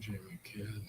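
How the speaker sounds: a breathy whisper of the name; whispered aside. Real, not acted. Muttering the rider's name to himself, whispered. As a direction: drop to a whisper under your breath.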